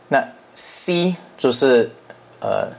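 normal talking voice

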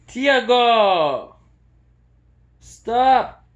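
sharp loud yell; shouting. Real, not acted. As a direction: yell to stop the dog. Yelling at dog misbehaving.

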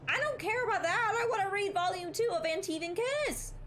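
high-pitched exasperated outburst; frustrated protest. Real, not acted. Protests being denied the romance sequel.

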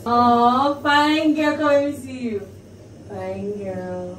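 high, loud cheering on her sister; excited hype. Real, not acted. Admiring sister's makeup, excited high cheer.

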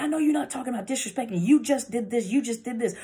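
acted-out defensive retort; indignant comeback. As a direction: play an accuser turning it around. Acts out deflecting partner arguing back.

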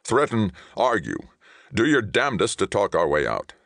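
high, forceful, tense instructions; tight order. A narrator's reading. Ray tells captain to talk them out, tight. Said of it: "Ray said tightly".

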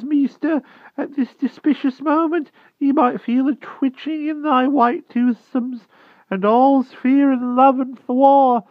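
put-on a frightened old hedgehog begging humbly; timid hedgehog voice. A narrator's reading. Hedgehog begging the Wart for mercy, high timid rustic voice.

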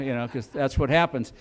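ordinary talk

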